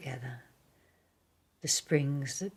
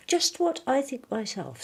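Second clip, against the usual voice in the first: higher and louder, proud bear; Pooh's voice; 10 semitones higher, 7 dB louder than usual. Acted. Pooh agreeing with the boy, higher proud voice.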